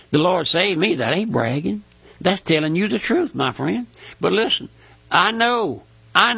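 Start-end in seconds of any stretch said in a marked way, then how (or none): none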